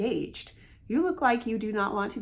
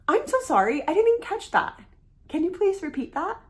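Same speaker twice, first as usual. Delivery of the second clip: higher, louder, bright engaged request; eager, interested request, much higher and louder; engaged and confident; 9 semitones higher, 4 dB louder than usual. Shown as a demonstration. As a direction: lean in and ask with energy. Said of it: "You feel the difference between the two".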